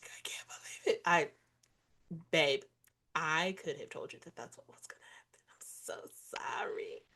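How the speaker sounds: soft whispered sympathy; hushed sorry. Real, not acted. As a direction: whisper it like consoling a friend. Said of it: "whispered consolation".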